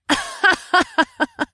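high throaty woman's laugh. A narrator's reading. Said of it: "her deep, throaty laugh".

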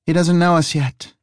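lightly voiced woman detective. Detective explains to lumberjack.

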